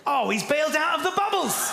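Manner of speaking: loud, high mock outrage; outraged shout. Real, not acted. Host outraged a friend stopped typing, loud shout. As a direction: cry out at the betrayal. Reply bubbles vanish.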